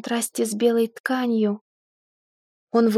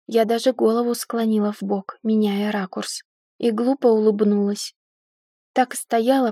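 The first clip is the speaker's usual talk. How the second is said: usual speaking voice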